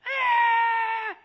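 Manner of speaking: loud high-pitched held scream; acted shriek of a frustrated child; child's scream. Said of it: "I screamed".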